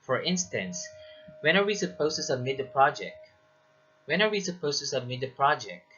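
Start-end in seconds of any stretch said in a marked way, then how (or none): 1.4-3.2 brisk question dropping on "project"; falling intonation. Shown as a demonstration. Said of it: "it falls". 4.0-5.9 quick information question, falling at "project"; falling intonation. Shown as a demonstration. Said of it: "it falls".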